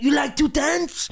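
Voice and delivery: high, slow sneering question right in his face; mocking taunt. Manager in the player's face, mocking high slow taunt. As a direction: taunt him, drawing it out with scorn. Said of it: "He's like".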